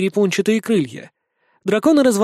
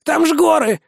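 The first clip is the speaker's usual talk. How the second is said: much higher, startled cry; alarmed vampire; 10 semitones higher than usual. A narrator's reading. Vampire objects to the magister, high and alarmed. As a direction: blurt it out in alarm.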